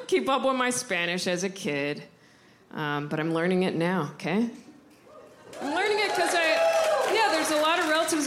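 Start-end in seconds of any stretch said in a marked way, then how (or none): 5.6-8.3 high burst on I; sudden outburst. Real, not acted. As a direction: blurt it out, much higher. Sudden outburst mid-explanation.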